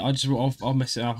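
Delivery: usual speaking voice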